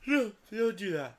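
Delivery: high, loud mock refusal; a hammed-up high voice refusing temptation; refusing himself. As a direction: push back on your own idea, loud and high. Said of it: "And I was like".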